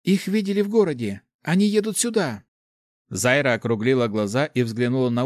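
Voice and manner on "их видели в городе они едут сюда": high, excited report; happy, high-pitched exclaiming; overjoyed news. A narrator's reading. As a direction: share great news in a raised, eager voice. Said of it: "с глазами полными счастья произнесла она".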